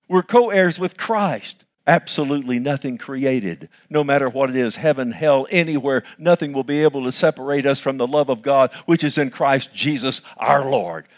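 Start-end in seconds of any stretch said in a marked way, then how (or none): none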